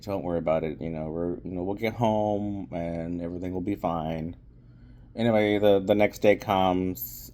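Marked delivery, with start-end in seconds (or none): 0.0-4.3 calm her down gently; mock reassurance. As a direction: lower and softer, soothing reassurance. [name] reassures Millie again.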